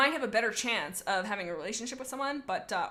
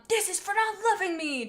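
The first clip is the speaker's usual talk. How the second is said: mildly raised, higher screech; 8 semitones higher than usual. Acted. Chaser lashes out at Firestar in a mild high screech. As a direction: snarl it up high, wounded. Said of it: "Chaser screeched".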